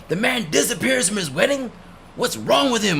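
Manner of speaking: high mock-outraged parent voice. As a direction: squeak out the parents' indignation. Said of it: "They're like".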